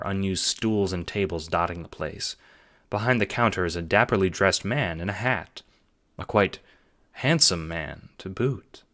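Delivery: usual speaking voice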